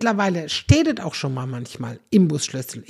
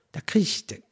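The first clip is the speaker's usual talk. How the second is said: ordinary talk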